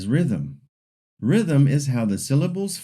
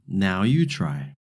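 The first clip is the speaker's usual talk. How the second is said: ordinary talk, nothing marked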